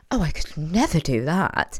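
slow, smug put-on voice; smug friend. Friend imitating smug coupled friends, slow and smug. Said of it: "single friends who be like".

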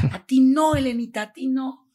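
loud, high cry of protest; anguished outburst. Retold or imitated. Friend hitting his car on hearing her story, anguished and loud. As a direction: re-enact a friend's furious disbelief. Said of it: "empieza a golpear su carro".

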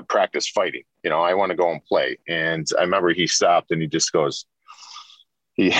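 ordinary delivery

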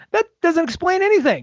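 playfully baffled; incredulous. Retold or imitated. Podcaster mocking a confusing TV line, incredulous. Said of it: "And I was like".

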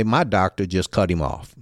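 usual speaking voice

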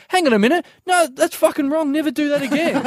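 high-pitched indignant telling-off; retold outburst, high and emphatic; sudden indignant switch. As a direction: flip into outraged scolding, pitch leaping up. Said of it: "I just switched mid-sentence".

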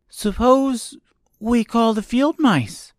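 put-on high girl voice, tentative suggestion; suggesting girl. A narrator's reading. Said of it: "she suggested".

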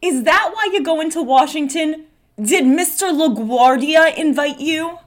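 theatrical, lifted-pitch prying; Dora in character. Acted. Dora questions Marie in scene.

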